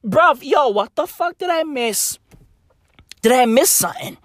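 ordinary talk, nothing marked

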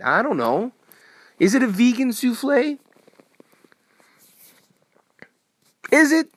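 high, loud and fast exasperated rant; heated rant. Real, not acted. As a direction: blow up at the people posting food. Ranting about food photos.

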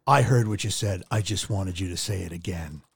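low, held-in mock annoyance; retold frustration. Host imitating frustrated son in commercial, low mock exasperation. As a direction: play a fed-up son, pitched well down and sinking. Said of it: "He gets all pissed off and frustrated".

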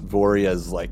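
ordinary talk, nothing marked

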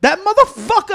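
hammed-up angry outburst; mock outraged shout. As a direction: shout it up high in mock fury. Retold outburst at candidate.

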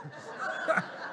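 loud breathy laugh at his own joke; burst of laughter. Real, not acted.

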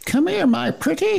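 high cackling witch line; a hammed-up wicked witch.

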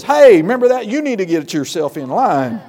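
loud, high call to a weak spouse. Retold or imitated. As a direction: snap them back into line. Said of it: "then the other one says".

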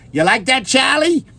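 high-pitched Boston mother imitation; Boston mom. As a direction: call out as a Boston mom on camera. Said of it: "the mom or the grandmother".

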